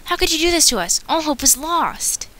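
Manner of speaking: extra mourning a betrayal by the pack; despairing extra. Acted. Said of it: "I'm gonna do the extras".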